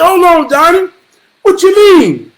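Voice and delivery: high-pitched startled interruption; taken aback. Retold or imitated. Nephew questioning Uncle Johnny on the phone, taken aback. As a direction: cut in, voice jumping up in disbelief.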